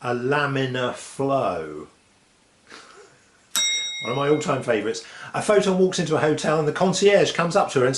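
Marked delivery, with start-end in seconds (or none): 0.0-1.9 very slow, drawn-out punchline; slow deadpan punchline. Acted. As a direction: stretch the pun out. Punchline delivered slowly.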